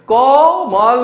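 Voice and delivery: teacher showing how कमल is pronounced; clear pronunciation. Shown as a demonstration. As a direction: pronounce कोमल carefully. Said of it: "इसमें हम क्या बोलते हैं?".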